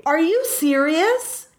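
high, slow, drawn-out disbelief; incredulous, pitch lifted high; astonished. Real, not acted. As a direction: stretch it out in real amazement. Stunned by the birthday coincidence.

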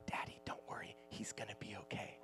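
hushed, whispered little reassurance; imitated child's reassurance. Daughter reassures dad during a story, in an imitated whisper. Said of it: "and she's like".

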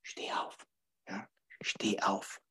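breathy whisper of a still inner voice. Retold or imitated. Coach voicing the quiet inner voice, whispering. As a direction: whisper it like a voice inside. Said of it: "stille Stimme sagt".

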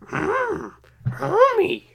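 very high cartoon wife murmur; Marge Simpson impression. Doing the cartoon wife, very high-pitched voice. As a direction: hum first, then call your husband high. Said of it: "sounds like Marge Simpson".

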